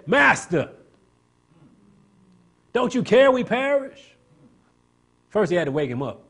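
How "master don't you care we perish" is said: high, slow pleading wail; desperate cry. Acted. Disciples in the sinking boat wake their master, desperate high cry.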